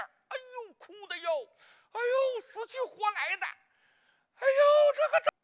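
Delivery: a hint of high lamenting cries; mock wailing. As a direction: ham up the tearful reunion lightly. Imitating wailing reunion.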